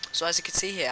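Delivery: normal talking voice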